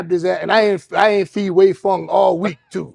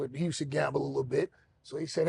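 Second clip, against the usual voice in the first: ordinary delivery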